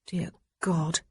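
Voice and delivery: plain reading voice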